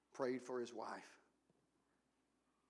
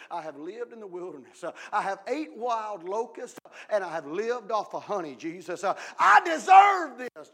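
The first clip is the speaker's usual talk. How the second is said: mock boastful shout; 5 semitones higher, 18 dB louder than usual. Preacher imagining John the Baptist boasting to Jesus, yelled. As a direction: brag until you're yelling.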